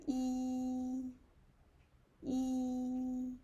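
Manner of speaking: E held high, long and level; teacher demonstrates a high flat first tone. Shown as a demonstration. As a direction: hold E flat at the top. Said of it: "is high and long and flat".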